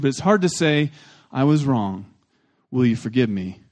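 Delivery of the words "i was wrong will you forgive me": lower, plain humble apology. Shown as a demonstration. Pastor contrasts a true apology with sorry, humble and low. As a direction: bring the voice down and ask humbly. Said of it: "without being humble".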